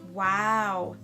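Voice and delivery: ordinary talk, nothing marked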